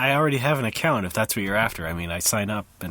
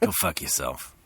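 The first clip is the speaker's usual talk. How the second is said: low gruff voice; 12 semitones lower, 5 dB quieter than usual. Pretend. Playing a Star Wars figure.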